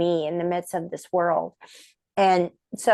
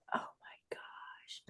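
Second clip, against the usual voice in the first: slow breathless whisper of awe; awed whisper; 21 dB quieter, much slower than usual. Retold or imitated. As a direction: whisper it, starstruck, very slowly. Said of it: "I was like".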